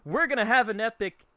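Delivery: loud, brash villain bragging; cocky shout. Acted. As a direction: boast it out like a rival. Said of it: "a Brando going over to a Joestar and going".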